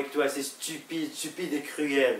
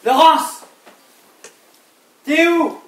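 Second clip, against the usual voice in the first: high, full-voiced shout for someone elsewhere; calling out loudly; 11 semitones higher, 13 dB louder, slower than usual. Husband hunting for Laurence through the house, shouting. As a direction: yell it out, pitched high to carry. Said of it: "pourquoi est-ce que tu cries".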